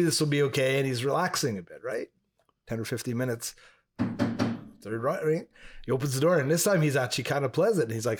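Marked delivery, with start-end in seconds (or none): none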